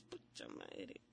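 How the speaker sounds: breathy whispered swear under the breath; whispered curse. Acted. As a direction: let it slip in a dismayed whisper. [name] reacts, caught out.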